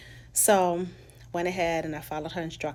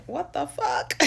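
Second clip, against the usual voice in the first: much higher, loud what-the-fuck in disbelief; shocked disbelief; 11 semitones higher, 4 dB louder, slower than usual. Retold or imitated. Seeing Pride and Haitian festivals together, high disbelief. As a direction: react like you can't believe your eyes.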